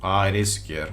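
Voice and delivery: ordinary talk, nothing marked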